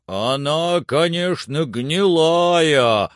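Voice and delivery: high, loud, drawn-out answer; Jupiter calling down. Acted. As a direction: holler the answer from far up.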